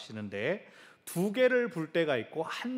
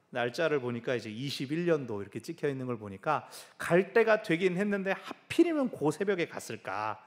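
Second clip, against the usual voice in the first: normal talking voice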